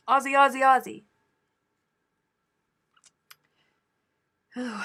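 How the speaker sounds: loud, high chant of Aussie; hollered Aussie chant; hollering. Acted. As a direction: belt Aussie out like a stadium cheer. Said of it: "from me hollering out".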